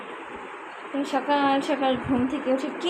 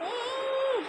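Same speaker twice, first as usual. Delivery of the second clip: high, soft 'eeh' of mock disgust; a squeaky yuck at dirty teeth; mock disgusted squeal; 12 semitones higher, 7 dB quieter than usual. Looking at rotten teeth.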